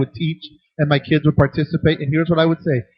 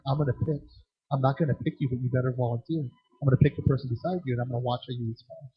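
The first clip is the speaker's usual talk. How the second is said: quiet coaching; 3 semitones lower, 7 dB quieter than usual. Retold or imitated. Coaching the boy ahead of time.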